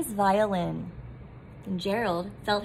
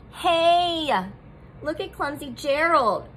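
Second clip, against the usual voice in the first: loud mocking jeer, higher; sneering; 6 semitones higher, 4 dB louder than usual. A narrator's reading. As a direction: jeer at the clumsy giraffe. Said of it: "the animals all sneered".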